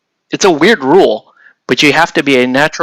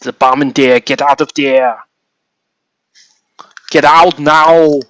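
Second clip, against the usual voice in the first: Governator voice; 3 dB louder than usual. Retold or imitated. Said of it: "my shitty Governator impersonation".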